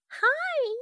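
put-on high, sweet ghost-girl voice; too calm creepy doll; creepy little girl.

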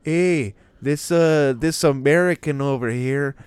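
high, loud mocking Canadian accent. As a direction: ham up a smug Canadian clerk with eh. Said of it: "this is the dude in fucking Tim Hortons".

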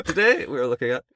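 high, loud echo of the narrator; mock narrator voice. Co-host parodying the narrator, high and loud. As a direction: blare the opening like a documentary host.

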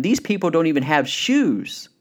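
enthusiastic, emphatic punch; excited salesman. Retold or imitated. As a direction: sell it as a golden opportunity. Excited salesman sees the opportunity.

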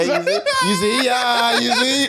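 high laughing squeal. Real, not acted.

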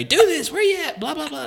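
teasing impression of a bossy partner; mimicking Maribel. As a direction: parody her rapid-fire orders in a squeaky voice. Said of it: "Maribel has been giving me directions".

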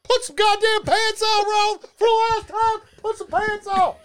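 very high, strained comic holler; mock scolding. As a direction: scold an unruly pet in a put-on screech. Acts owner scolding the monkey.

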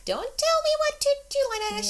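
squeaky high mocking voice; shrill mock protest, way up high; high mock retort.

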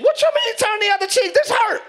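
high, loud mock outrage; indignant self voice. Believer objecting to God after a slap, high indignant mock protest. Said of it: "your voice is like".

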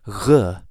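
voiced "r" growled at the back of the throat; voiced guttural. Shown as a demonstration. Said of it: "put some voice into it".

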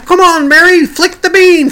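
high, loud call-out; shouted call. Retold or imitated. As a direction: belt it out like a dancer cheering. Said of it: "And he'd say".

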